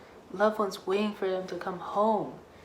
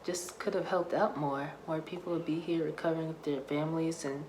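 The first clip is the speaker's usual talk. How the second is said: usual speaking voice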